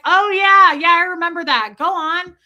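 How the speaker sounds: loud, high, hammed-up agreement; shouted phone chat. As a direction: call back over running water.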